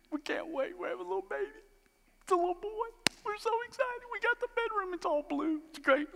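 high, giddy mock parent voice; gushing new parents. Mocking excited new parents.

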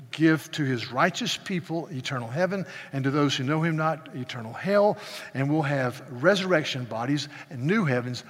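normal talking voice

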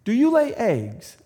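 a hint of a high, slow old woman. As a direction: ask it slowly like a curious old lady. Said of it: "asked the woman".

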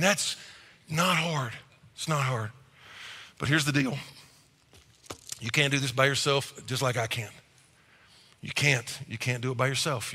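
ordinary talk, nothing marked

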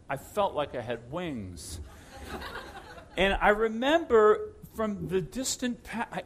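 normal talking voice

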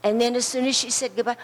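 ordinary talk, nothing marked

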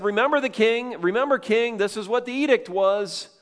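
loud, high reminder to a king; insistent group demand. Retold or imitated. Men went as a group to the king, insistent. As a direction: press the point, pitched high and loud.

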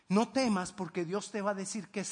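ordinary delivery

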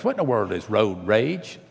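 usual speaking voice

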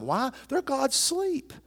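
usual speaking voice

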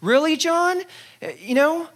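high, incredulous; can you believe it; mock disbelief. Preacher voicing Jesus's imagined disbelief at John, incredulous.

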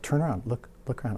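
murmured little prompt; hushed inner prompting. Retold or imitated. Quiet voice in his head nags him, hushed prompting.

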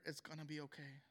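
low, soft and steady comfort; soft reassurance. Retold or imitated. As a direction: drop to a gentle, quiet promise. God's comforting words retold.

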